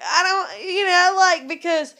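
breaking down mid-rant, crying through it. Real, not acted. Ranting about lonely rich Americans, she breaks down crying. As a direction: voice cracking high into real tears.

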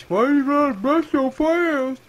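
high, slow whiny mimicry; mock the complainers in a drawn-out high voice. Player imitates people bitching about the game, mockingly. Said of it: "I've heard people bitch".

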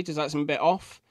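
normal talking voice

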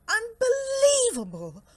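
very high, loud cry of disbelief; incredulous bear at full voice; exasperated outburst. Acted. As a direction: wail it in outraged disbelief. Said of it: "rumbled Bear".

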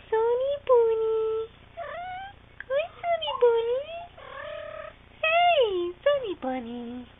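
high, loud cooing call; pet talk voice. Real, not acted. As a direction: call the bunny sweetly. Calling the cat.

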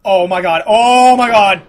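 loud cry, building on the repeat; exasperated outcry. Real, not acted. Player reacting to a fall, loud and exasperated.

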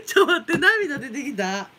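breathless laugh-talk, words slowing; laughing to tears. Real, not acted. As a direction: laugh until you cry. (涙が出るほど笑いながら)

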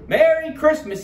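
loud, high festive exclamation; joyful cry. A narrator's reading. Turkey popping out of the box, high excited cry. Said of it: "he cried".

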